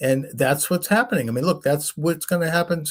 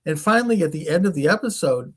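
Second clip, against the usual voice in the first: ordinary talk, nothing marked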